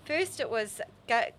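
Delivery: ordinary delivery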